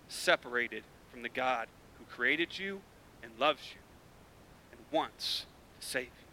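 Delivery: a low, sober warning; solemn warning. Real, not acted. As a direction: speak it gravely and hushed.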